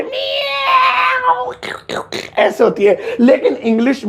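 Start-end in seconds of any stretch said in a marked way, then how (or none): 0.0-1.5 long, high meow like a cat; cat meow imitation. Shown as a demonstration. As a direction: mew like a real cat, stretched out. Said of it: "Hindi में तो ऐसे होती है". (बिल्ली की तरह लंबी, ऊँची म्याऊँ)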